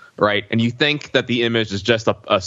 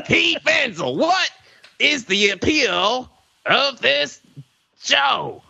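high slow twangy drawl; Joe Exotic impression; 14 semitones higher, slower than usual. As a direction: drawl the question up high, slowly. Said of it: "Joe Exotic impression".

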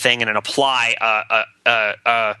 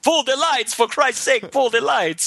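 high, exasperated pleading; beg, fed up, much higher; exasperated plea; 11 semitones higher, slower than usual. Retold or imitated. Desperate improviser begging.

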